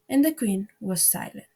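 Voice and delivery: ordinary delivery, nothing marked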